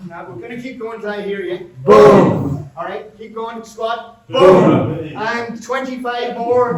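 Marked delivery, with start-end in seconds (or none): 1.8-2.6 big high shout of boom. Shown as a demonstration. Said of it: "shout boom as loud as you can". 4.3-5.0 shouted boom. Shown as a demonstration. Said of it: "shout boom as loud as you can".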